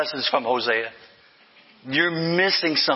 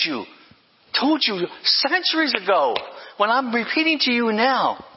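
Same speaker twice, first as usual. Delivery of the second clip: higher, urgent preaching voice as Jesus; Jesus rebuking the Pharisees, fervent; passionate rebuke; 5 semitones higher than usual. Retold or imitated. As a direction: press the Pharisees hard. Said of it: "he tells them".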